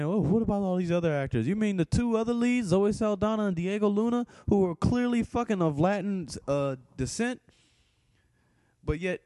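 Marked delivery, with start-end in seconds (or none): 0.0-7.4 high, mocking voice of imagined critics; a whiny objector's voice, pitched well up; mock objection. Aping online critics who object, in a high mocking whine. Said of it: "people probably saying".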